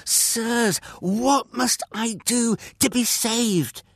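high, overwrought plea; shaking with emotion. A narrator's reading. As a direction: beg them for an answer, overcome with emotion. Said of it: "his voice shaking with emotion".

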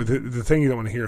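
normal talking voice

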